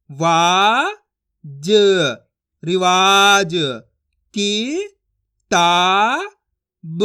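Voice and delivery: long, loud, separated syllables; teacher's drawn-out phonics drill; syllable-by-syllable reading. Shown as a demonstration. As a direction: stretch each sound, pause, then blend the word slowly. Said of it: "मैं किस तरीके से एक एक अक्षर का उच्चारण करके पढ़ रहा हूँ".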